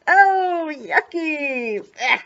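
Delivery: high, drawn-out grossed-out groan; playful disgust. Acted. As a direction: recoil with exaggerated yuck for a small child. Reacts to dog licking ice cream.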